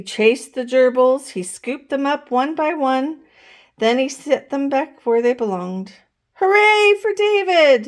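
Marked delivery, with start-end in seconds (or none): 6.3-7.9 high, loud hooray; cheering yell. A narrator's reading. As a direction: cheer for the hero. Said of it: "yelled the class".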